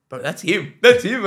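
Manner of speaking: rising excited hush turning loud; spotting your hero, can't contain it; retold excited burst to dad.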